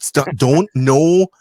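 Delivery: loud, high retold telling-off; mock scolding. Partner stopping him at the dinner party, loud mock scolding. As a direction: cut him off with forceful imitated stops. Partner heading off his topic.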